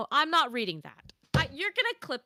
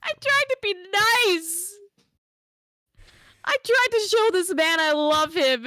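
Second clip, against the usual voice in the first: exasperated protest; 8 semitones higher, 6 dB louder than usual. Real, not acted. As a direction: wail it in indignation. Protests after being teased.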